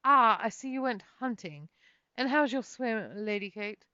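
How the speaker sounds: a noble greets Kate grandly, with haughty airs; imperialistic air. Acted. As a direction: talk down to her like a haughty noble. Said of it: "with an imperialistic air about his person".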